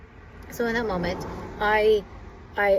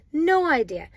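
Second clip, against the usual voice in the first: higher, louder fed-up repeat; exasperated inner thought; 6 semitones higher, 5 dB louder than usual. Retold or imitated. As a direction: stamp it down once more. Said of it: "you in your head, you're thinking".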